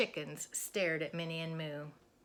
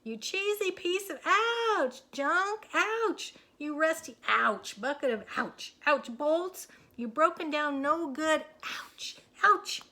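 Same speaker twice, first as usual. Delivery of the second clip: high loud cries broken by ouches; frantic yelling; 9 semitones higher, 8 dB louder than usual. A narrator's reading. Minnie yelling at the tractor while pecked. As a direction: yelp through the insults.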